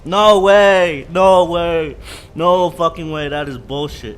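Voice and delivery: loud, outraged yelling; furious shout. Real, not acted. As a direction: blow up at the game. Dies or fails in game.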